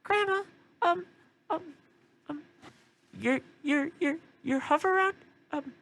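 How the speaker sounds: put-on high, hesitant kid voice; little boy voice. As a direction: stammer like a nervous little grandson. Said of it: "Lil Johnny's just like".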